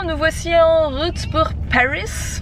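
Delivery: put-on hammy English accent on Paris. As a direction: say it like a bad English tourist. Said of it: "je prends un accent anglais pourri". (accent anglais pourri)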